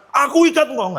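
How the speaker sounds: high, forceful mock shout. Shown as a demonstration. Minister shows how not to bind a spirit, high shout. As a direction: shout the binding as an overdone command. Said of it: "saya ngomongnya bukan".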